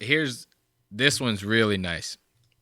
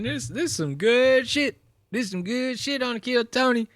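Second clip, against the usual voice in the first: higher, slow Texas crowd drawl, a touch hammed-up; mock Texas drawl; 11 semitones higher than usual. Comic imitating the Texas audience, slow higher Texas drawl. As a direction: stretch it out like a Texan yokel in the crowd. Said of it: "You know how they talk down there in Texas".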